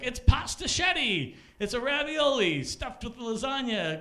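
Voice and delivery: high, slow, loud ad pitch fading off; mock ad voice. Comic imitating an Olive Garden TV ad, hammed up. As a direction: sell it like a cheesy commercial. Mocking ad announcer.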